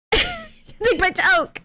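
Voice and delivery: high, slow, laughing repeat; high drawn-out joking voice. Pretend. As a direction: stretch it out high and teasing. Joke line repeated, drawn out.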